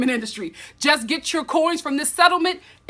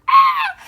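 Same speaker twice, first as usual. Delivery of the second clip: sudden high squeal of delight; high gasp; 9 dB louder than usual. Real, not acted. Gasp at the cork.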